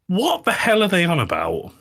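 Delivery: incredulous. Real, not acted. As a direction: ask it in total disbelief. Exasperated rant.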